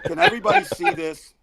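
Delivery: high, loud call to the audience; loud, high-pitched appeal to the whole audience; too loud. Real, not acted. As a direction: shout it out to everyone watching. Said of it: "Too loud".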